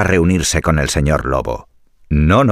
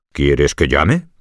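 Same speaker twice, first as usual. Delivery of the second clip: wolf voice; 6 semitones lower, much slower than usual. Acted. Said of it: "preguntó el señor lobo".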